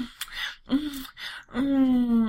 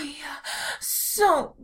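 ordinary talk

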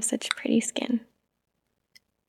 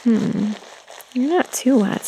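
normal talking voice

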